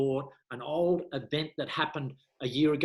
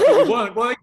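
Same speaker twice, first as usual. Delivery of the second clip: much higher and louder knowing wife; a teasing, knowing wife who caught him; wife catching him out; 10 semitones higher, 13 dB louder, faster than usual. Retold or imitated.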